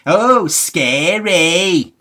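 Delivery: high, loud mock fright; deadpan fake scare; sarcastic. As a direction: say scary as if totally unimpressed. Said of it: "not being serious".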